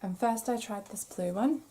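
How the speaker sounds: frog in the throat; hoarse, catching throat; croaky voice. Real, not acted. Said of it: "I still haven't got that frog out of my throat".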